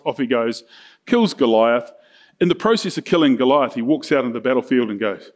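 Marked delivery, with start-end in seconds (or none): none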